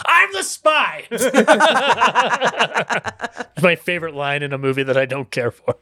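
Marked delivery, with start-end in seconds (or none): 0.1-1.0 high, laughing hammy reveal; hammy spy reveal. Retold or imitated. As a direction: squeak the confession out.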